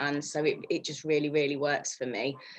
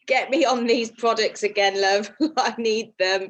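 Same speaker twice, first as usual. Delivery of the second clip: quoting herself through laughter, a touch louder; laughing self-quote; 5 semitones higher, 5 dB louder than usual. Retold or imitated. Telling the group how she begged Michelle, laughing. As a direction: laugh while you ask for the products. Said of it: "and I just thought".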